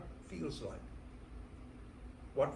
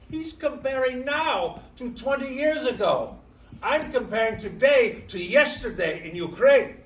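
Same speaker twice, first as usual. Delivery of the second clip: higher and louder, driving the point; rising emphasis; 6 semitones higher, 13 dB louder than usual. Real, not acted. Immigrant telling Americans about perspective, higher and louder. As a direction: build it up and lean in hard.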